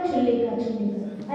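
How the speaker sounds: क्षुल्लिका mumbled softly as "chhullika"; mimicked mispronunciation. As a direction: quietly imitate the wrong chh sound in क्षुल्लिका. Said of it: "कई लोग क्या बोलते हैं?".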